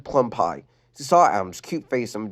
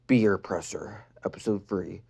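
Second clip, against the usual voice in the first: whispered title; 4 semitones lower, 7 dB quieter than usual. Acted.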